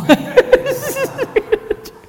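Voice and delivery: high-pitched, helpless cackle; high laughing fit. Real, not acted. Speaker cracking up at the possession prank, high loud laugh. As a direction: laugh loud and high at your own tale.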